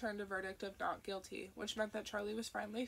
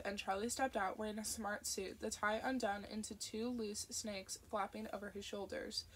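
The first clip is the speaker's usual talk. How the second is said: ordinary delivery, nothing marked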